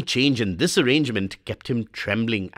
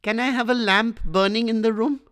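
put-on boy's plea, much higher; Swami pleading; 11 semitones higher than usual. A narrator's reading. Frightened boy begging father to let him sleep in the hall, much higher. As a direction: beg like a scared little boy.

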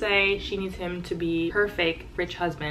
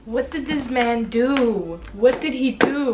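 raised, loud disbelief; shocked demand; 4 semitones higher, 4 dB louder than usual. Real, not acted. Reader aghast at what the character did, shocked. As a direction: demand to know, stretched out in shock. Shocked reaction to plot twist.

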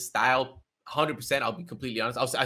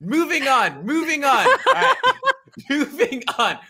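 much higher, laughing while brushing it off; 7 dB louder, slower than usual. Real, not acted. Host laughs, moving past his embarrassing roar, high and giggly. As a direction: giggle it away and move on.

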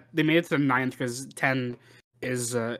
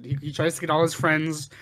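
ordinary talk

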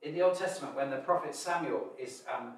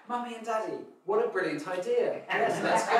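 put-on high, bright-eyed kid voice, louder at the end; eager children; 8 semitones higher, 7 dB louder than usual. Children delighted at their parents' walk idea, eager and giggling. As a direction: gush like a thrilled little child with a laugh. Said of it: "And the children go".

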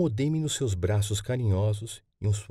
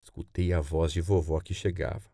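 plain reading voice

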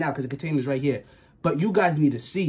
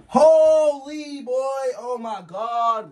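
slow, higher stunned exclaim; shocked drawl; 6 semitones higher, much slower than usual. Real, not acted. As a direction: stretch it out in disbelief. Shocked at the rat.

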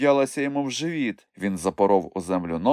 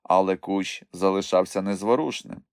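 plain reading voice

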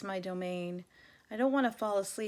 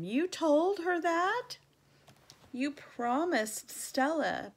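higher, louder, slow accusing demand; angry reproach; 8 semitones higher, 5 dB louder, slower than usual. Acted. Gorilla glaring at his friend, angry and slow. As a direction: drag each word out at him in outrage. Ivan glares at Bob.

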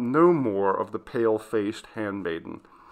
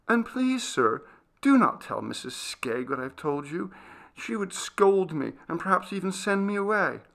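put-on high, anxious girl's voice; worried young maid; timid servant girl, pleading; 9 semitones higher than usual.

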